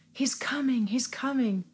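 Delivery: lifted, falling cry at no extra volume; imitated crying out. Little Hadassah announcing the coming, lightly imitated cry. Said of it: "little Hadassah is crying out".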